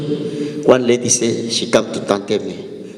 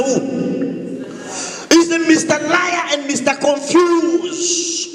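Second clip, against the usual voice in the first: high, ringing preacher's cry; forceful preaching; 11 semitones higher than usual. Acted. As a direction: preach it out to the whole church at full force.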